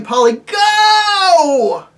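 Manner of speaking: very high, loud, drawn-out yell; shouting. Acted. Diggory shouting to Polly to go, loud. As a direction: yell the command with all your might.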